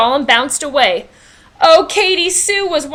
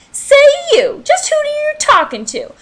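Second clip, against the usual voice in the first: high, loud, bossy playground queen; bossy bully; 13 semitones higher, 4 dB louder than usual. A narrator's reading. Recess queen bossing a kid around. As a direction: take over the schoolyard.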